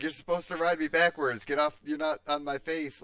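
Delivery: hammed-up outrage; mock indignant scolding. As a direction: scold her like she's sitting wrong. Acted complaint to Killian.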